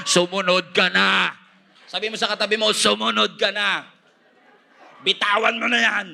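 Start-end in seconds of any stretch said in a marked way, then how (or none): 0.1-1.4 slow, raised commanding call; growing louder. Acted. Preacher voices God to a runaway, loud slow command. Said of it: "mas lalong lumalakas ang boses". 2.7-6.1 high, raised urging call. Acted. Has congregation repeat God's command.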